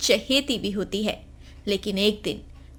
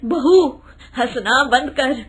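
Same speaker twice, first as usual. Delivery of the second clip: loud, sharp order to stop; sharp command; 5 dB louder than usual. Acted. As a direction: cut her off loudly. Mother-in-law snaps at her. (ज़ोरदार डांट)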